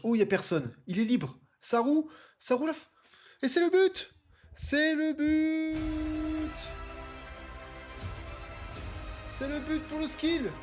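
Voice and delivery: excited sports commentary. Real, not acted. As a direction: scream the goal like a TV commentator. Live commentary, goal scored.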